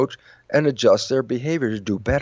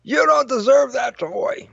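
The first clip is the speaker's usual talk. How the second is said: much higher, slow mock yell of an annoyed dad; imitated dad yelling; 13 semitones higher than usual. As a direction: play the fed-up father snapping at his kid in a squeaky pitch. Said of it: "So the dad yells at the kid".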